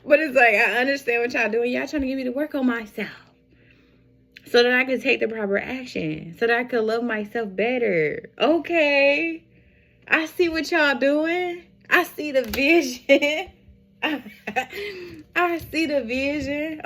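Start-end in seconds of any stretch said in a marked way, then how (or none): 0.1-13.5 high, loud, playful talk; excited. Pretend. Playful address to the spirits. 14.0-15.3 high giggling laugh. Real, not acted. Laugh at reading.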